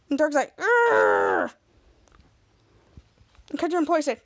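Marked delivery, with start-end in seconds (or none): 0.6-1.5 drawn-out "urgh" growl; frustrated growl. Retold or imitated. Dirk fuming at the employee, frustrated growl. Dirk's wordless angry reply.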